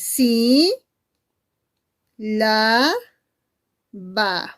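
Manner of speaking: "sí, la, va" in long, separate syllables. Shown as a demonstration. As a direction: draw out "sí", "la", "va" with clear pauses between. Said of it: "cuántas sílabas tiene la palabra sílaba".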